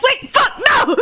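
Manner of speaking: high, hammed-up yelp of refusal; mock panicked protest. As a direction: imitate someone suddenly backing out. Retold panicked reply in teasing exchange.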